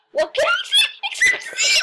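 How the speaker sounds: high, slow laughing shout. Real, not acted. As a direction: crow it out through a laugh. Laughing shout after calling killers stupid.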